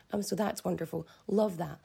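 ordinary delivery

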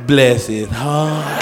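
very slow, syrupy grandma drawl; mock sweet grandma. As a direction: drag it out sweetly. Grandmother judging ugly baby.

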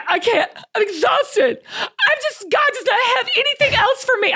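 high, whiny half-laughing complaint; hammed-up exhausted wail, pitched high; mock whine. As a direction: moan it like you're done with everything. Imitating her own complaint.

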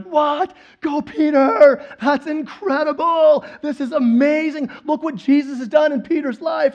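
amazed cheering. Acted. Disciples watching Peter walk on water, hammed-up cheering. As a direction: cheer him on, stretching out the big words.

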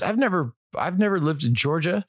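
high-pitched imitation of her worried side of a call; retold stressed woman. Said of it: "She goes".

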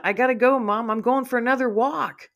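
loud, high, eager puppy shout; excited yell. A narrator's reading. Digger tells Mom he's off on another walk, excited yell. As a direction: holler it happily while itching to run off. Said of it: "he yells out".

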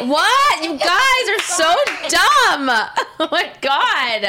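high, loud, laughing protest; laughing at her dumb friends. Real, not acted.

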